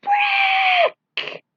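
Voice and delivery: very high, loud piercing cry; agonized scream. Acted. Rick crying out as the energy tears through him. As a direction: scream out in pain.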